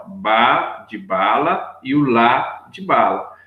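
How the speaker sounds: slow, spelling out each syllable; slow, deliberate classroom pointing; slow syllable demo. Shown as a demonstration.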